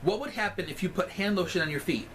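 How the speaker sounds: ordinary talk, nothing marked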